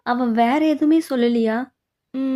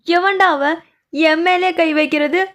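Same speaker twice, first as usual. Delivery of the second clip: furious shout; 5 semitones higher, 4 dB louder, slower than usual. Acted. Man grabbed, demands who touched him.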